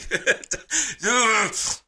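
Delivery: high teary laugh; emotional high laugh; choked laugh. Real, not acted. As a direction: laugh to hold back tears.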